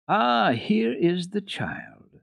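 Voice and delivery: high, slow, kindly grandma calling out; friendly old grandmother. Acted. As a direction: greet the child gently, drawn out. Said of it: "friendly voice".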